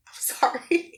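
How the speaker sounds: whispered, high little sorry; giggly whispered sorry to her partner; sheepish apology. Retold or imitated. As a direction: shrink back with a whispered apology. Apologizing sheepishly after the fight.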